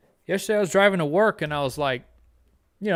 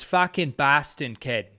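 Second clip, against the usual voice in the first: slow Boston accent, open "ah" in "Boston"; real Boston accent; slower than usual. Shown as a demonstration. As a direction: say "Boston" like a local Bostonian, flat open vowel. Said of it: "That's what it is".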